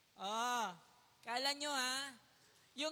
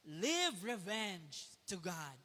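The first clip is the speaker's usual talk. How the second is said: low, slow, solemn line; slow solemn reading; 7 semitones lower, much slower than usual. Shown as a demonstration. Preacher reading the lesson point to the congregation, low and slow. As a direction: lay it down low and slow like a verse.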